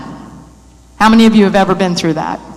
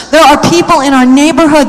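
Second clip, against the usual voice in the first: ordinary delivery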